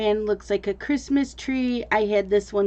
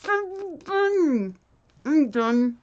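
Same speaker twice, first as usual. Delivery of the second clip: barely able to speak through marshmallows; cheeks stuffed full; 4 semitones higher than usual. Real, not acted. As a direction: mouth crammed full. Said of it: "till we can't say it anymore".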